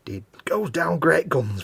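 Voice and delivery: slow, drawn-out northern slogan delivery; mock northern slogan. Shown as a demonstration. Friend recalling a beer slogan in a slow northern accent. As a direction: say "It goes down great guns" slowly with a broad northern lilt. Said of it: "very northern".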